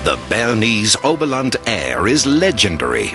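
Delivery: ordinary talk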